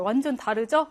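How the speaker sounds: ordinary delivery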